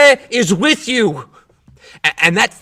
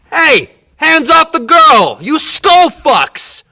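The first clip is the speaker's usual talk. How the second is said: loud, raised-pitch angry shout; angry challenge; 7 semitones higher, 4 dB louder, slower than usual. Acted. Man tells police "hands off the girl", angry shout. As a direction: yell to make them back off.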